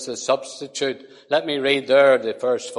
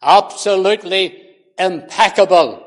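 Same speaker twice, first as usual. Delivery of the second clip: preaching at full force; 6 semitones higher, 9 dB louder than usual. Acted. Preacher to congregation, on sinlessness: loud preaching.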